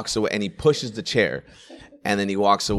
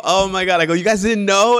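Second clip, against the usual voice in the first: higher, louder, faux-shocked; mock surprise; 10 semitones higher, 5 dB louder than usual. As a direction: act amazed that they never knew.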